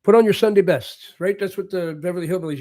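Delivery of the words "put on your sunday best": put-on old saying. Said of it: "they used to say".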